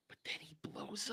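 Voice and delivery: hushed, breathy whisper of the death; dramatic whisper. Real, not acted. Telling a friend Mu dies, in a hushed whisper. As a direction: drop to a hush for the tragic moment.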